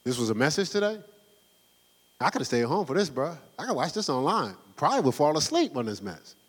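lightly voiced unimpressed member; mock bored congregant. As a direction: grumble like a let-down listener. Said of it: "y'all gonna be like".

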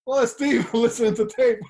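amused disbelief breaking into a laugh; laughing quote. Retold or imitated. Retelling catching the coach with his tape, laughing.

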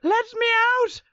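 high, loud mock shout. Granddad in the coffin starts his prank shouting. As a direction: shout it up high, playfully urgent. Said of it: "The granddad starts shouting".

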